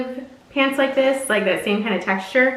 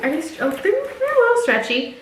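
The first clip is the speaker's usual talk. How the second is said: ordinary talk, nothing marked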